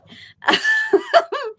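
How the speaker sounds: high, loud burst of laughter; squealing laugh of disbelief; big laugh. Real, not acted.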